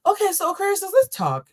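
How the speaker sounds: very high, careful sweetness; put-on kind voice. Reader retrying a talk with Aquarius, put-on kind voice. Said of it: "I'm gonna use my kind voice".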